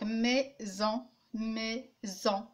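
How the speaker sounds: "maison" slowly split, mai, then son; two detached beats on "maison"; syllable by syllable. Shown as a demonstration. Teacher dividing the example word, syllable by syllable. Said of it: "Si j'applaudis".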